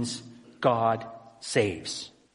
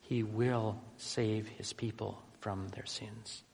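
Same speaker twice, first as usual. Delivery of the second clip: will slow and weighty, softer overall; stress on will; 8 dB quieter, slower than usual. Shown as a demonstration. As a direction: lean hard on will, say the rest slowly. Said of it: "There's an emphasis in that word".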